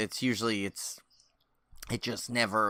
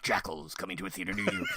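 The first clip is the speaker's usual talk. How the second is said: movie trailer voice; 3 semitones lower, 8 dB quieter than usual. Pretend. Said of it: "at the end of the trailer before the title".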